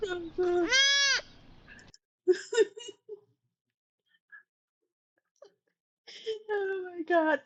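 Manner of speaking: high, breaking laugh through the words; laughing so hard it nearly turns to tears; helpless laughter. Real, not acted. Laughing on a call at her friend Ivy's story, real helpless laughter.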